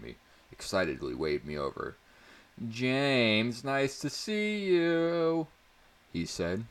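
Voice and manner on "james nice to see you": high, slow, goofy nerd voice; dorky greeting. Acted. Davis greeting his best friend James on the bus, high slow dorky voice. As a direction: stretch it out like the biggest dork alive. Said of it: "in seemingly the dorkiest way possible".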